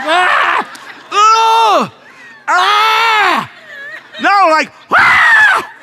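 high, loud mock straining cries; straining grunts. Preacher mimics constipated listeners, high straining shouted grunts. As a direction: grunt like you're pushing hard. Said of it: "we're so constipated".

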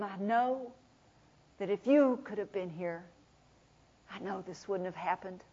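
sorrowful and hushed; soft grieving lament. Acted. Mary lamenting to Jesus, soft and low.

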